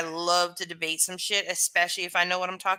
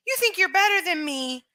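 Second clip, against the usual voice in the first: high, mocking "you think you're better" voice; mocking accuser; 12 semitones higher, 3 dB louder than usual. Streamer mimics haters, high and mocking. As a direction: mimic a hostile commenter, pitched up. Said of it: "they turn it into".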